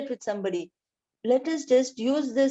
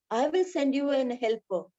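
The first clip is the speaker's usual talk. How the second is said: ordinary talk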